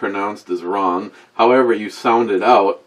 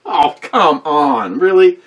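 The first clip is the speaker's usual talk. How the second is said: slow, crying-out complaint, rising high and loud; mock wailing disbelief; 6 semitones higher, 5 dB louder, slower than usual. Real, not acted. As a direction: wail it like you've been robbed. Assistant cuts off the Italian.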